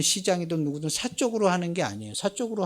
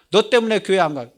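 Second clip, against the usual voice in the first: raised, louder mock outburst; loud defiant retort; 6 semitones higher, 8 dB louder than usual. Scoffer blaming someone for leaving church, loud mock defiance. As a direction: throw it out loudly, imitating a scoffer. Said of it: "큰 소리로 막 욕하죠".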